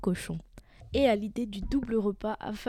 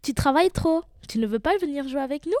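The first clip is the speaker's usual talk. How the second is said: high, loud mocking little pigs; teasing; 6 semitones higher, 6 dB louder than usual. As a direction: tease your hard-working brother, pitched up. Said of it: "se moquaient de lui".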